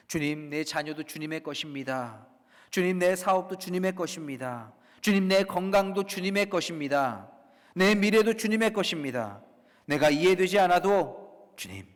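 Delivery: building steadily from measured to loud; fervent confession. Acted. Believer giving everything over to the Lord, building confession. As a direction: surrender each thing with rising conviction. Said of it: "이 고백이 제단 위에".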